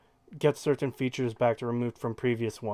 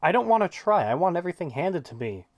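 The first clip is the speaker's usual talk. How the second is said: mock whiny casual; 5 semitones higher, 3 dB louder than usual. Mocking the casual player.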